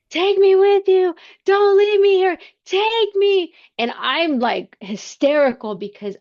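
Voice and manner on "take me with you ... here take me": high, loud, pleading cry to be taken along; desperate scream. Retold or imitated. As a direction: scream it out in despair, begging. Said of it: "I'm screaming in my mind".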